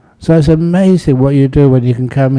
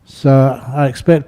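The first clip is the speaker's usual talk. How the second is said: usual speaking voice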